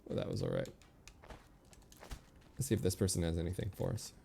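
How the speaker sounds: ordinary delivery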